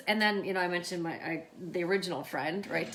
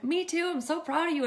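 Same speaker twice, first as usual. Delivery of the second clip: high, loud re-enacted praise; proud gushing; 11 semitones higher, 8 dB louder than usual. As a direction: gush with pride to your niece. Retold gushing praise of niece.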